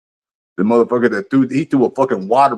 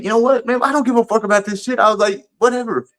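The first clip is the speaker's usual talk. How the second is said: much higher, exasperated I-don't-care voice; dismissive brush-off; 10 semitones higher than usual. Retold or imitated. Quoting himself waving off the angry girl, exasperated and dismissive. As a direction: toss it off like you're done with her.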